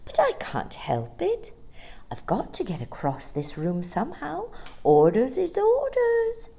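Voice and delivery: a hint of a kindly light saying sorry; gentle sunlight voice. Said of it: "said the sunlight".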